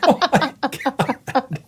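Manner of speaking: slow, high breathless disbelief; drawn-out stunned whisper of amazement; surprised. Real, not acted. Stunned recognition.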